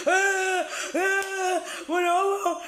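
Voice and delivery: hammed-up weepy lament; mock wailing mother. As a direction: parody her crying over her child. Mocking her crying on video.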